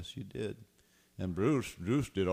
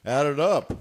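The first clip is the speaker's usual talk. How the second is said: loud; 5 semitones higher, 12 dB louder than usual. Real, not acted. Loud call to add up.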